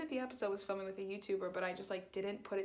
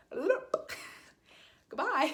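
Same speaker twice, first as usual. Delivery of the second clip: much higher, louder laughing bail-out; panicked laughing goodbye; 8 semitones higher, 6 dB louder, much slower than usual. Retold or imitated. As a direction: blurt it and hang up laughing. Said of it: "Then I went".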